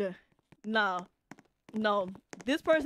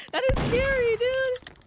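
high, loud alarmed cry; startled and scared; spooked; 12 semitones higher, 6 dB louder, slower than usual. Real, not acted. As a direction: exclaim it, really rattled. Frightened by the attacker.

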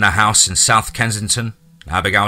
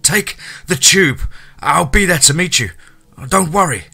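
urgent; 8 semitones higher, 4 dB louder than usual. Acted. Urgent phone plea.

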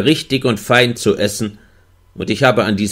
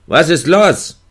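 Ruf; 7 semitones higher, 3 dB louder than usual. A narrator's reading. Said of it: "Und dann rief er".

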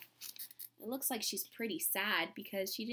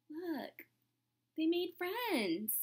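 ordinary delivery

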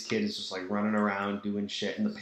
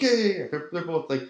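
excited dad; 6 semitones higher than usual. Retold or imitated. Dad voiced in the story.

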